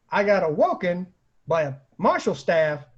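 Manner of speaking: ordinary talk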